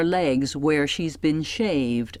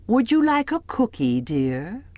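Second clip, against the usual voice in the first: sweet kindly wife; 7 semitones higher than usual. A narrator's reading. Kind wife offering a cookie.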